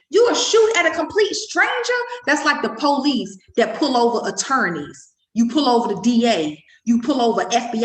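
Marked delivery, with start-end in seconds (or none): 0.1-2.0 shouted repeat. Real, not acted.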